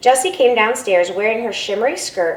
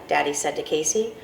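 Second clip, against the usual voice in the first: plain reading voice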